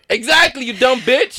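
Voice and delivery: sudden high, loud jab; aggressive. Acted. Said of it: "I just feel like being aggressive".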